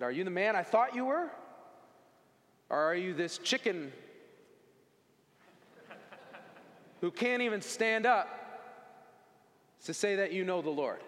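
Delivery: a father demanding an answer; pleading dad. Retold or imitated. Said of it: "He said".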